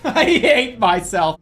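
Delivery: slow, loud self-mocking laugh; laughing groan. Real, not acted. Gamer laughing at his own failure, loud and slow. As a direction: laugh it out at yourself.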